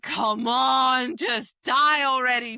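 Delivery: loud, high yell at the page; frustrated shout. Retold or imitated. Reader shouting at the villain in the book. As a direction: yell at the villain to just die.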